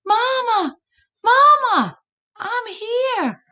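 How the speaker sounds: put-on high, loud child's holler. Lost possum child hollering to his mama, high and loud. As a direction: shout back to your mama. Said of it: "Epasamondus hollered".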